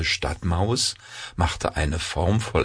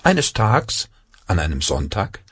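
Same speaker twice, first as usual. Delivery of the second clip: ordinary delivery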